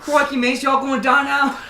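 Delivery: put-on high, loud, laughing Australian accent; hammed-up Australian accent.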